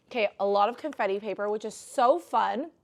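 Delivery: ordinary delivery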